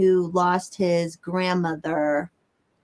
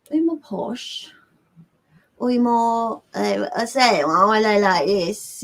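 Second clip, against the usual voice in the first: badly faked posh British accent; hammed-up British, feeling for the accent; mock Queen's English. Shown as a demonstration. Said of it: "I know I'm doing it bad".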